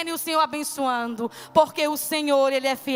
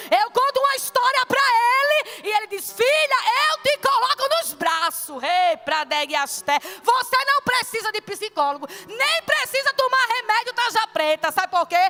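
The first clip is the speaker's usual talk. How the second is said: loud, raised preaching with bursts of tongues; fervent preaching; 2 semitones higher, 4 dB louder than usual. Acted. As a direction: preach it out to the congregation. Preaching surge, speaking in tongues.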